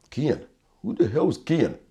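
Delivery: high, sharp incredulous question; stunned disbelief. Retold or imitated. Pupil told of a death he can't place, high and incredulous. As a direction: snap back, baffled by the name. Confused by wrong name.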